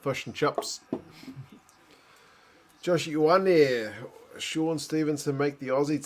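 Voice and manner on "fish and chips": "fush and chups" Kiwi accent; broad New Zealand accent. Shown as a demonstration. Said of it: "explain the Kiwi accent to the wife".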